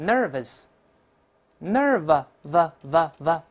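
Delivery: nervous broken down slowly, nerve, then a long v; slow drilled pronunciation. Shown as a demonstration. Teacher drilling nervous for students, slow syllable by syllable. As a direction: stretch nervous out sound by sound for the class. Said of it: "大声来一遍".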